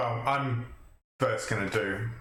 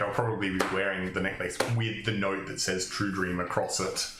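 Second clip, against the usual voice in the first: ordinary talk, nothing marked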